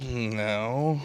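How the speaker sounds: low curt no through a curled lip. Shown as a demonstration. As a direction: curl your lip and mutter no. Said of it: "like that lip curled?".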